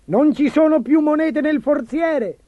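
high-pitched squawk of alarm; a panicked official shrieking up high. A narrator's reading. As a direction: cry it out high, drawing out the complaint. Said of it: "strillava il cancelliere".

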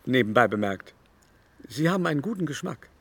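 ordinary delivery

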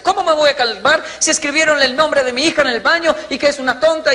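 high, agitated complaining mother; angry mother. Retold or imitated. Mother at school over insult to her daughter, high and agitated. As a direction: storm into the school demanding answers.

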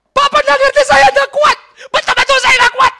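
high, full-volume desperate yelling; imitated very strong shout. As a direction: mimic a counselee insisting at the top of the voice. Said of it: "Nah, itu kuat banget". (teriakan sangat kuat, menirukan)

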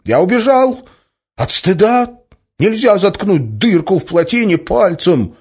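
very high, loud cries; high shouting. A narrator's reading. Young idealist defends himself, shouting high and loud. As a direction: keep crying out in shame. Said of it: "тонко выкрикнул".

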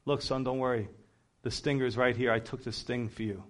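calm him down like a father would; gentle fatherly comfort. Retold or imitated. Father reassuring his panicking son, soft and low.

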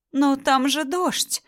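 high, slow squeak; squeaky. Acted. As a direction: squeak it out like a rubber toy. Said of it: "пропищала Анна".

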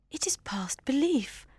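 high whispered disbelief; shocked whisper. Acted. Jane whispers her shock to Elizabeth. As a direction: breathe it out in disbelief.

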